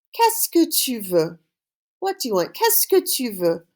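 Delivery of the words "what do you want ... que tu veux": ordinary talk, nothing marked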